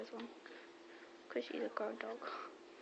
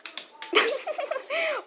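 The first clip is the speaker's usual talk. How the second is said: high laugh; 4 dB louder than usual. Real, not acted. Laughing at the camera view.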